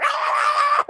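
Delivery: short high-pitched scream; startled figure's scream. Acted. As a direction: let out a sudden high shriek. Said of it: "turns around and goes".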